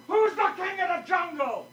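a cocky lion demanding an answer, pitched high; bossy lion. Acted. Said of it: "he says to the elephant".

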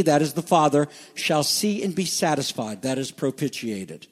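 ordinary delivery

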